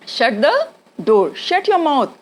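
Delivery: very slow, careful words; slow demo. Shown as a demonstration. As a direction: spell it out for learners. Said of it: "ज़बान पे आना चाहिए".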